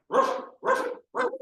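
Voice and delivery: high, loud yappy barking; dog bark. Acted. Dog barks in story.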